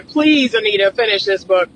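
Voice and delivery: usual speaking voice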